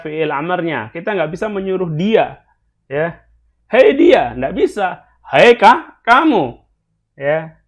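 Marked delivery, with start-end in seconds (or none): 3.7-4.3 a loud, high call to grab attention; mock command yelled out. Shown as a demonstration. Teacher acts a command to "him. 5.3-6.6 loud, high hail of a listener; mock call shouted across a room. Shown as a demonstration. Teacher acts calling "you.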